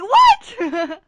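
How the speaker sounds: loud high stunned What; shocked what. Retold or imitated. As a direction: squeal it in disbelief. Friend's message, shocked reaction.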